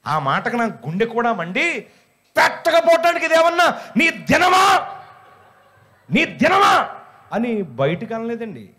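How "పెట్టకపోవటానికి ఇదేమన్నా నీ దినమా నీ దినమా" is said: much higher, louder furious yell; shouting with burning anger; angry shout. Retold or imitated. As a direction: blast it out in exasperation. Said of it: "కోపంతో".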